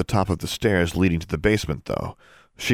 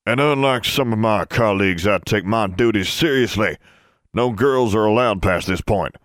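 put-on stuffy old wizard; 3 semitones higher, 3 dB louder than usual. Portrait wizard lectures the girl.

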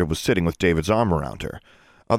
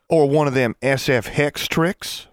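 slow, higher Ozark twang, eager; Southern suggestion; 7 semitones higher, slower than usual. A narrator's reading. Forbearance suggests a hex trick in a slow Southern twang. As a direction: offer the idea slowly in a country accent. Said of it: "Forbearance suggested".

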